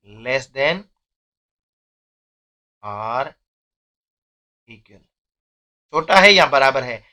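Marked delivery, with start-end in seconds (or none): 0.1-5.0 "less than", pause, "or", pause, "equal", each part set apart; slow spaced reading. Shown as a demonstration. Teacher spelling out a symbol's name for students, slowly. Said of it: "इसको पढ़ते हैं less than or equal".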